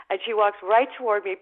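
normal talking voice

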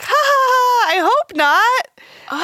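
loud, high fake ha-ha, then a drawn-out reply; forced nervous laugh. Woman humouring a man's kidnap joke, high forced laugh.

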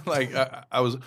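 normal talking voice

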